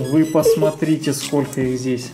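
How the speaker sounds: ordinary talk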